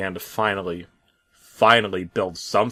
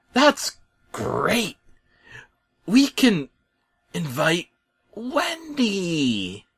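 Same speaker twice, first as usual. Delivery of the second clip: very high, small toy-like voice; bulldozer voice; 10 semitones higher, much slower than usual. Acted. A bulldozer repeating Bob, in a very high squeaky voice.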